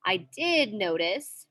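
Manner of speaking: "did" stretched with a rise and fall in pitch; emphatic DID. Shown as a demonstration. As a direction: lean on "did" before "notice". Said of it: "that's the emphasis".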